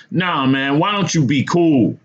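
high-pitched appeal; pleading. A narrator's reading. Narrator refuses to follow the madman, pleading. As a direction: plead with him, voice lifted well up. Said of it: "I pleaded".

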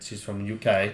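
a lightly attempted British accent, quiet; bad British accent. Shown as a demonstration. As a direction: try a British accent and mostly miss. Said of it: "that's a terrible accent".

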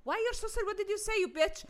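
high, heated retold challenge; outraged comeback, acted out; indignant confrontation. Retold angry confrontation with the man.

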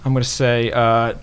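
ordinary delivery

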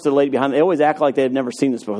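normal talking voice

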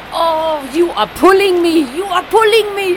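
loud, high East German accent imitation; doing the East German attendant's accent, loud and high. Imitating the East German flight attendant in a loud, high German accent. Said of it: "This is how she do".

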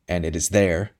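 Anthony cuts in on the priest, lightly harsh. A narrator's reading. Understated harsh interjection. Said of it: "began Anthony harshly".

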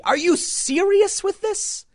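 high, slow, incredulous; exasperated disbelief. Real, not acted. To the apologizing YouTuber, slow high incredulity. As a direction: drag it out in disbelief.

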